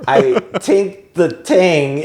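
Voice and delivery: put-on Irish accent, "tink" and "ting" with hard t; slow Irish "tink the ting", t not th. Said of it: "It's like".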